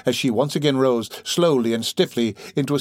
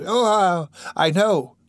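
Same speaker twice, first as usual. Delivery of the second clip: higher-pitched burst of remembering; sudden cry; 8 semitones higher, slower than usual. Acted. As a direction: cry out as the memory suddenly returns. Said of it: "she cried, jumping fiercely up".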